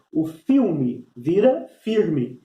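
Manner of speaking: "filme vira firme" said very slowly, word by word; slow, drawn out. Shown as a demonstration. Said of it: "numa linguagem mais caipira". (devagar, destacando "filme" e "firme")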